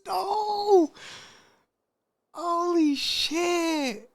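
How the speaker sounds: loud, very high drawn-out scream; real horrified shriek; shocked outcry. Real, not acted. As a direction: wail it loud and high, stretched out. Listener reacting in shock to the story.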